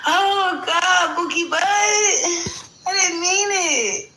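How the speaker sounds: high, loud fake sobbing; hammed-up tearful cry to ridicule; mock crying wail. Streamer mocking a rival's apology with a loud fake wail.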